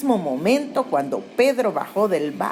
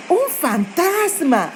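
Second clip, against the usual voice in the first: higher, slow scared cry of ghost; frightened cry; 9 semitones higher, slower than usual. Retold or imitated. Disciples in the boat see a ghost, crying out in fright. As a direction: voice the disciples' first panicked shout. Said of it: "empezaron a gritar y decían".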